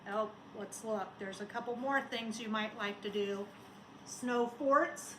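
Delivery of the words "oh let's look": ordinary talk, nothing marked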